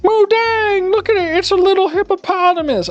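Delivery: put-on squeaky baby-talk gush over a cute hippo; mock high cooing voice; high gushing woman. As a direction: coo it like a smitten fan. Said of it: "they're like".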